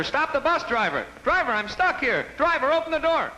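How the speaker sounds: high, frantic mock yell of a stuck old lady. Stuck old lady yelling after the bus driver, frantic high mock yell. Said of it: "she started yelling at the driver".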